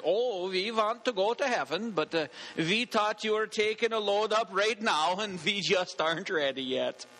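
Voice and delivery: put-on joke-telling Scandinavian accent, w's turned to v's. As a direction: answer as a comic Scandinavian churchgoer. Acted Scandinavian character answers in joke.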